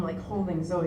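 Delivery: ordinary talk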